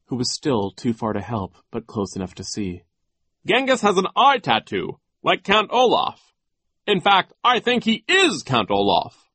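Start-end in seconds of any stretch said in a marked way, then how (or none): 3.5-9.3 high, loud, eager discovery; excited realization. A narrator's reading. Mister Poe revealing the villain, high excited voice. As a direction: announce it like a breakthrough. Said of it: "Mister Poe said".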